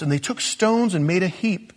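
normal talking voice

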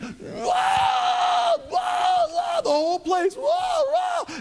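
high, loud crowd cheering; stadium shout. Retold or imitated. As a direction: yell like the whole stadium erupting. Crowd screams at home run.

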